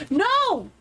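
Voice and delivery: sharp, high-pitched no; outraged cry. Real, not acted. Player protesting as the scene unfolds, outraged. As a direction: blurt out a protest, pitch leaping up.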